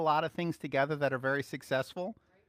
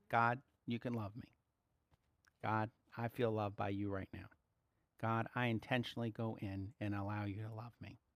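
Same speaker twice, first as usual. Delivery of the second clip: soft prayer; 6 semitones lower, 7 dB quieter than usual. Shown as a demonstration. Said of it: "you just say that".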